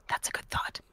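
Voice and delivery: soft whispered answer; hushed, breath-only reply. Acted. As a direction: whisper it in character. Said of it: "this whispering thing is very weird".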